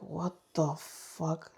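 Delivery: stunned hushed whisper. Retold or imitated. Stunned that nothing was there.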